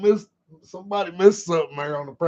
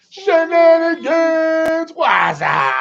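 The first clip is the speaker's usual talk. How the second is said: high, loud, long drawn-out holler; whooping hello shout; shouted greeting; 7 dB louder, much slower than usual. Real, not acted. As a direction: holler it out, stretching the words. Shouts greeting to someone arriving.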